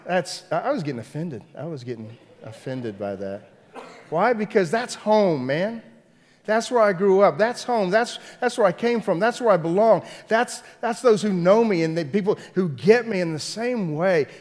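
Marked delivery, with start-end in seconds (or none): 4.1-14.3 loud, raised, insistent preacher's cadence; impassioned preaching. Real, not acted. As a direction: proclaim each phrase with rising fervor. Preaching at full force.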